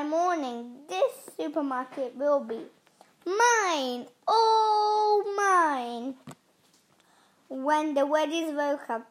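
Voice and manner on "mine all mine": drawn-out gloat, stretching "all"; villainous gloating. A narrator's reading. Evil pea claiming the supermarket, higher gloating. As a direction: crow it like a villain claiming everything.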